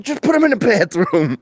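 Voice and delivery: imitated movie gangster. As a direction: repeat the boss's exasperated order. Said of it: "He's like".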